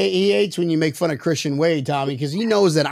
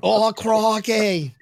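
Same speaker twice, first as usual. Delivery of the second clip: slow, drawn-out Australian; mock Australian accent; 3 semitones higher, much slower than usual. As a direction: stretch it out in a put-on Australian accent. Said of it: "better than the Australian accent".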